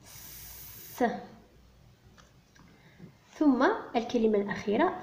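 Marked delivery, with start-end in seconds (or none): 0.0-1.0 "س" as a bare voiceless hiss, no vowel; just hiss the "س" softly; a long unvoiced sss for "س"; hissed s sound. Shown as a demonstration. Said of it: "سا".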